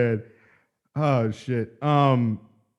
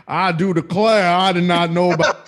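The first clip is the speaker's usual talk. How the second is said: high, slow, laughing Southern belle drawl; mock Southern lady, higher and louder; thick Southern accent; 7 semitones higher, 6 dB louder, slower than usual. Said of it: "put on that thick Southern".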